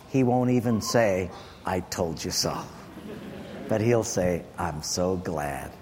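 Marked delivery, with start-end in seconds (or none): 1.6-2.6 low, softened quote; hushed, low imitation of God; gently voiced God. God's gentle words, voiced by preacher. 4.6-5.7 very low, slow and soft; hushed, tender low murmur; tender voice of God. Retold or imitated. God's tender reply, voiced by preacher.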